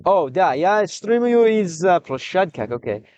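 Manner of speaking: ordinary talk, nothing marked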